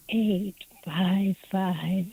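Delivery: slow, seductive phone-line voice; sexy voice. Acted. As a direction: purr each digit slowly, like a hotline. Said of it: "say the phone number in a sexy voice".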